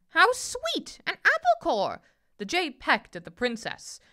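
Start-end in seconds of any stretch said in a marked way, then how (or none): 0.1-2.0 high, loud, delighted bird; squawking jay. A narrator's reading. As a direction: exclaim like a bird finding treats. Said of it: "the jay squawked".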